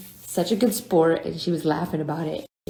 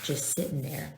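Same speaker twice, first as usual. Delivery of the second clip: ordinary talk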